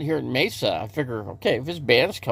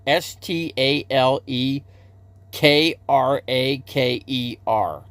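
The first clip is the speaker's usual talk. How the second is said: slow, letter by letter; slow careful spelling of a name; slower than usual. Shown as a demonstration. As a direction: spell each letter deliberately for the viewers. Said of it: "The guy's name is Stale Cracker".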